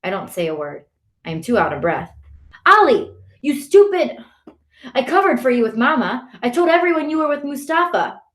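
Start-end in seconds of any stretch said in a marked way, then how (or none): 2.7-8.2 high, exasperated brother voice; angry scolding. A narrator's reading. Brother who covered for Ali with Mama, angry scolding. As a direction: lay into a younger brother.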